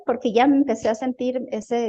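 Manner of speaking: ordinary talk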